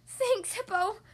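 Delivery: high, grateful storybook duck; crying out happily. A narrator's reading. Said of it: "cried Duck".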